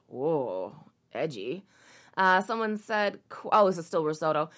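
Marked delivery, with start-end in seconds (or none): 0.1-1.6 sarcastic mock awe. Real, not acted. Sarcastic aside on article.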